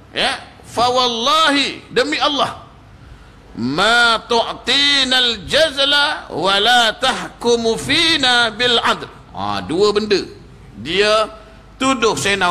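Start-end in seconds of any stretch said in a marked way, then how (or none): 0.7-9.1 high, slow angry Arabic oath. Retold or imitated. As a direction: swear it out in anger. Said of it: "dia marah, ya".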